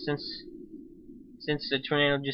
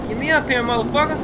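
frustrated yell; 11 semitones higher, 6 dB louder, faster than usual. Real, not acted. Tornado lifting him.

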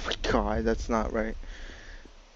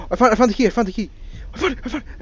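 high, loud excited yelling; excited shouting; 11 semitones higher, 6 dB louder, much faster than usual. Real, not acted. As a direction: shout it out in triumph. Finding the key in horror game.

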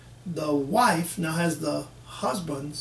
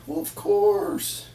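imitating a husband; 4 semitones higher, slower than usual. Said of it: "You ask a husband".

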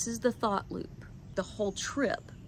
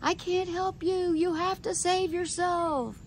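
mock yell; 9 semitones higher than usual. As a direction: call it out high like a proclamation to the room. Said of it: "So I yelled it out".

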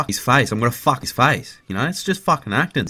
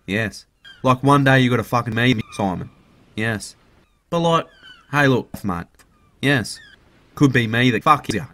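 usual speaking voice

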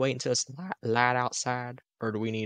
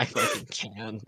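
fake southern accent. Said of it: "not even that good of a southern accent".

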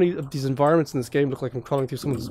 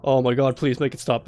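ordinary talk, nothing marked

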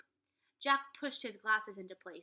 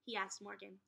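ordinary delivery, nothing marked

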